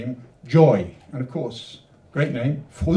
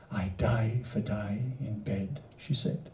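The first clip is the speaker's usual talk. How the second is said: much softer and lower, trailing to a breath; hushed refrain; 4 semitones lower, 10 dB quieter, slower than usual. Acted. Poet closing the refrain, softer and lower. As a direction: drop it down to a whisper at the end. Refrain tail dropped low.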